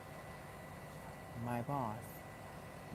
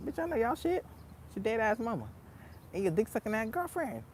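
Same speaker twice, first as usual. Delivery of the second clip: mock trash talk, much higher pitch; sassy comeback; 6 semitones higher, 4 dB quieter than usual. Coworker roasting the bullies back, mock sassy insult. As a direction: snap back at bullies with attitude. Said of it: "I be getting on their ass too".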